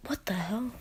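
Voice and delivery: voiceless, hushed what the hell; stunned, barely breathed out; whispered disbelief. Retold or imitated. Shocked girl hearing grandpa just died, whispered.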